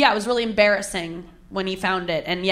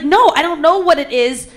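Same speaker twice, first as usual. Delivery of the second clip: retold indignant denial, higher and louder; offended denial; 7 semitones higher, 6 dB louder than usual. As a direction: push back, mock-affronted. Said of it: "really offended".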